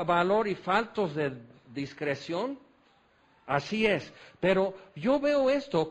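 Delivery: usual speaking voice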